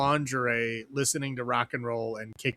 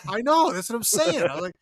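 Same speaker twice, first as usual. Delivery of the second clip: ordinary talk, nothing marked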